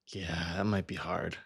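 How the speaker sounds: normal talking voice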